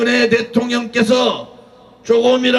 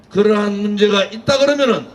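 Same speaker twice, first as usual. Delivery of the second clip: usual speaking voice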